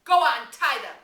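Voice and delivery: loud, pushing command; urging order. Acted. Jack urging the tribe to tie the twins, loud. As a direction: drive them on.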